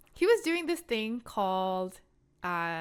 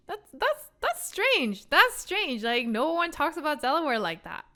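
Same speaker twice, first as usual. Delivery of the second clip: higher, louder, mounting disbelief; baffled reaction; 7 semitones higher, 5 dB louder than usual. Retold or imitated. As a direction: blurt it out as the oddness sinks in. Retelling baffled reaction to video.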